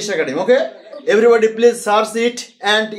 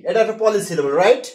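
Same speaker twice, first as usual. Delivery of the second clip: ordinary talk, nothing marked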